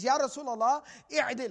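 loud, acted-out blunt call; blunt demand. Bedouin walks in on the Prophet, bluntly demanding.

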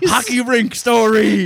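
high, drawn-out heckler holler; mock audience yell. Fan requesting an old bit from the comic, high stretched mock yell. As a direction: holler a request slowly from the crowd. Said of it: "people start screaming stuff out".

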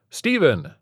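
high, bright-sounding chirpy doctor greeting; a cheery, grating doctor on the phone. A narrator's reading. Doctor greets Stephen over the phone, overly chirpy. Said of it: "came the doctor's overly chirpy and grating voice".

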